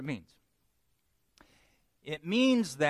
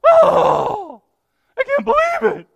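hammed-up squeal, much higher and louder; dramatic eighth grade girl; 16 dB louder than usual. Preacher mocks an eighth grade girl, hammed-up high wail. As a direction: overplay a teen girl's despair. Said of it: "they're like an eighth grade girl".

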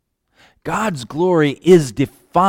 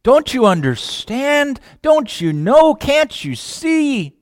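high, pleading, exasperated questions to God; a child pleading with God, high and exasperated; frustrated child; 10 semitones higher, slower than usual. Retold or imitated. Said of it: "I say, frustrated child".

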